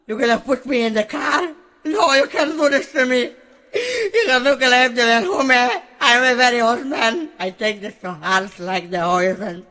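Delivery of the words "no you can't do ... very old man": higher, half-laughing begging; laughing plea. Acted. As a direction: beg while laughing.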